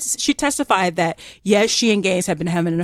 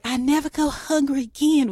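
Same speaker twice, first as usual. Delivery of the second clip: high, mock-genteel Southern accent; pleasant Southern belle; 7 semitones higher, 3 dB quieter than usual. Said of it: "with this pleasant Southern accent".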